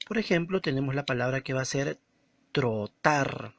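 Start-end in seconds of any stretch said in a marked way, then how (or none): 2.5-3.5 "trotar" split into tro and tar; syllable by syllable. Shown as a demonstration. Teacher showing the two syllables of trotar, syllable by syllable. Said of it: "Hay dos sílabas".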